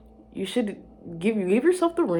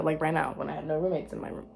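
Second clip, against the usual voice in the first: usual speaking voice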